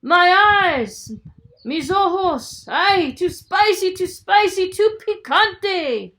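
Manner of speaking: high, loud wailing scream; screaming in pain. Acted. Giant with chile in his eyes, screaming. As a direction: howl as the chile burns. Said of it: "El Gigante Terrible screamed".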